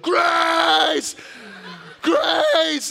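a father yelling in horror for his wife; panicked scream. Retold or imitated. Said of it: "my reaction when I saw that was to scream".

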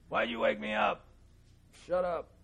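high, put-on voice of a cranky man just woken; mock grumpy sleeper. Preacher jokes Jesus snaps at disciples for waking him, mock grumpy. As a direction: play it as a comic, annoyed grump.

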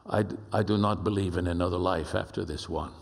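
low imitation of a Spanish-accented man; Pablo's deep Spanish accent. Ambassador confessing disbelief to his friends, imitated deep Spanish accent. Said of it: "deep Spanish accented voice like this".

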